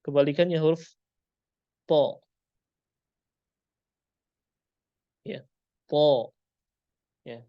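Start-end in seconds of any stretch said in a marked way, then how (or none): 1.9-6.3 TO said heavy with the tongue raised; a full, rounded "to", not thin "ta"; thick to. Shown as a demonstration. Teacher shows the opposite letter, heavy "to". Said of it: "huruf to dia harus tebal".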